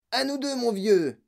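put-on high-pitched boyish battle cry; high, eager holler; excited yell. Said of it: "hurla-t-il".

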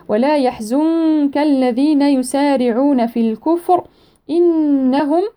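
tajweed recitation; Quran recitation. Shown as a demonstration. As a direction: draw the verse out melodically. Teacher recites verse slowly.

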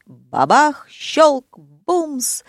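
crash sounds spoken as separate, punched words; imitated crash noises. A narrator's reading. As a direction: act out each bang and click. (звукоподражание грохоту)

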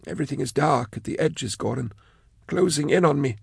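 high, thin, trembling-soft voice; lost and slipping away; frail frightened man. Acted. Sark fading, frightened.

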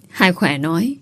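plain reading voice

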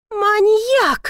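high, loud shriek of accusation; alarmed yell. A narrator's reading. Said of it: "завопила она". (испуганный вопль)

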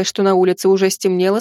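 usual speaking voice

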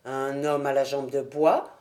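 jambe de bois asked back with a lifted, pleased rise; a detective secretly thrilled by a clue; pleasant surprise. Acted. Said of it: "en éprouvant une agréable surprise".

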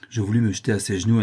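plain reading voice

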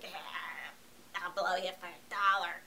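half-hearted imitation of a crack-addled streetwalker; mock street hooker. Reviewer voicing a real-life street hooker, mock low voice. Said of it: "they're like".

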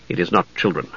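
low, quiet knight's voice; grim knight. Acted. Knight contradicts the young mage, grim and low. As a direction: keep it low and soft.

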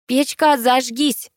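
put-on high, commanding child's chant; girl's excited cry. A narrator's reading. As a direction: call on the stove eagerly. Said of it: "завопила Кристия". (азартный детский возглас)

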